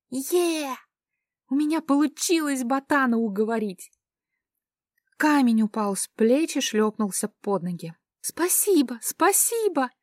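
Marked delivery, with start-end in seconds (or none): 0.1-3.9 excited. Acted. Heroine cheers at her success. 8.2-9.9 high, excited squeal of thanks; squealing with joy. Acted. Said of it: "завизжала от радости".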